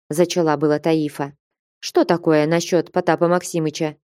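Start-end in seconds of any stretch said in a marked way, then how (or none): none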